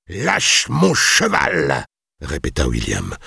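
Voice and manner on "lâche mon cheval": very slow, high threat; menacing repeat. A narrator's reading. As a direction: stretch each word into a warning. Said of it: "cria William d'un ton menaçant". (ordre menaçant, martelé lentement)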